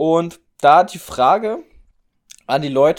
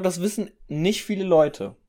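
usual speaking voice

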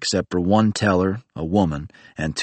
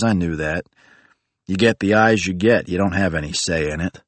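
ordinary delivery

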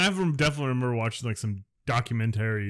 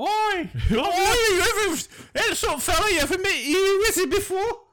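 cartoon London accent; 4 dB louder than usual. Friend mimics the wizard in a London accent, hammed up. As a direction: squeak out a silly London wizard. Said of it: "a London accent".

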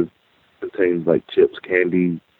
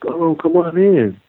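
welcoming secretary; 9 semitones higher, slower than usual. Retold or imitated.

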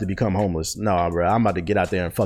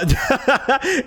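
high laugh; 3 dB louder than usual. Real, not acted. Laughs after joking about himself.

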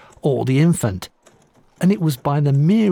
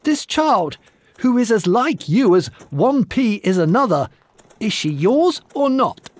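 furious demand; 8 semitones higher than usual. Acted. Giantess interrogates the groom.

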